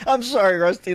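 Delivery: ordinary delivery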